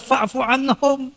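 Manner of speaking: slow, chanted Arabic verse; Quranic recitation. Acted. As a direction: intone it slowly like a recitation. Quran verse quoted again. (lantunan ayat perlahan)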